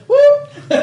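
piercing high whoop with laughter; imitated falsetto "whoop!"; Lockhart's excited whoop. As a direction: yelp it loud and very high. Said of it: "I just hear him in the".

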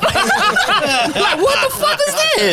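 high laughing disbelief. Real, not acted. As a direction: laugh through the words.

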